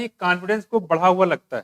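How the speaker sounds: ordinary delivery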